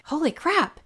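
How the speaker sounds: high, stunned holy crap; amazed at the sight; shocked. Retold or imitated. Retold shock at turtles.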